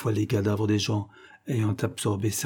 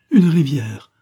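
loud, higher cry of hope; excited cry; 4 semitones higher, 8 dB louder, slower than usual. A narrator's reading. Widow hitting on a new source of water, excited cry. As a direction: call it out as a sudden idea. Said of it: "dit-elle alors".

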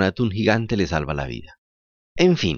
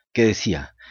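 ordinary delivery

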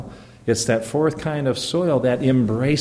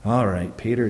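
lower, deflated mock sigh; imitating a disappointed teacher who settles for less; mock resigned sigh; 4 semitones lower, 4 dB quieter than usual. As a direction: play Jesus giving up on Peter, mock-resigned. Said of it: "This is not Jesus going".

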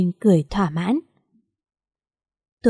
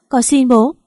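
higher, louder begging cry; desperate plea; 4 semitones higher, 4 dB louder than usual. A narrator's reading. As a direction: plead with rising panic. Father collapses coughing.

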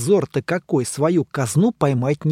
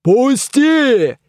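shouting; 9 semitones higher, 6 dB louder than usual. A narrator's reading. Door struggling to escape.

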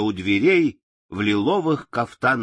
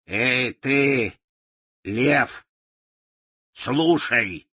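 put-on mumbling old witch; much slower than usual. A narrator's reading. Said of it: "прошамкала она".

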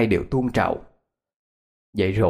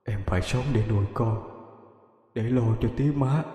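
softer, faraway voice drifting from the void; distant ghost voice; 2 semitones higher, 4 dB quieter than usual. Acted. Dead lover's ghost warning the girl, soft and faraway. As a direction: speak softly as if from far beyond. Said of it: "Giọng nói nghe dời dời, xa xăm".

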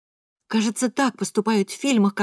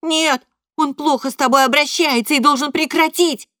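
higher, louder outburst; boy shouting; 6 semitones higher, 4 dB louder than usual. A narrator's reading.

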